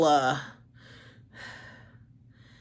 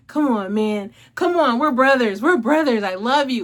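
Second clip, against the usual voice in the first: higher, louder, begging plea; pleading brother; 5 semitones higher, 6 dB louder than usual. Retold or imitated. Imitating the narrator playing a brother who begs to make up. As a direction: beg your brother to take you back. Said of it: "Lee Samuels be, like, so".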